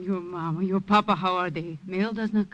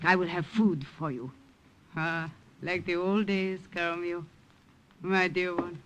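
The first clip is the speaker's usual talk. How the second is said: soft, fading, tender; tender lullaby; 5 dB quieter than usual. Acted. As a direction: soothe him to sleep. Grandmother lulls tired grandson.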